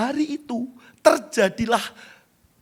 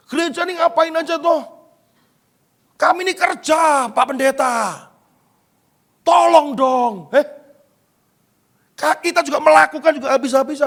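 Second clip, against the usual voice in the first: high, raised-voice complaint; acted exasperated protest; 7 semitones higher, 3 dB louder than usual. Preacher acts out church blamed and answering pastor, exasperated.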